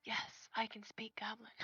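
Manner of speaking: hushed, unvoiced aside; whispering to the party. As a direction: whisper it so the goblin can't hear. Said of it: "I wanna whisper".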